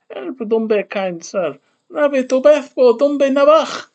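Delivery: hammed-up high cry for help, building; acted plea for help. Acts out plea in invented language.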